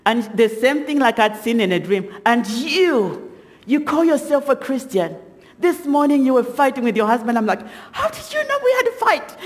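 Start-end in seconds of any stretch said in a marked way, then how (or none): 2.3-7.4 mildly acted evil accuser, raised pitch. Possessed woman accusing the speaker at the funeral, evil voice. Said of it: "this woman is telling him with this evil voice". 7.9-9.4 high-pitched mock squeal of disbelief; shocked retort. Startled wife answering the woman who knows her secret, shocked retort. Shocked reply to accuser in story.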